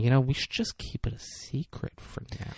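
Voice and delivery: soft, seductive whisper; sexy whisper. Retold or imitated. Fiancée coaxing him to keep the engagement secret, sexy whisper. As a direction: whisper it flirtatiously, like a shared secret. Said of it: "then she's all sexy".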